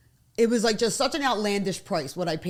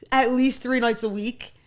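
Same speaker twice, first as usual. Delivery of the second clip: usual speaking voice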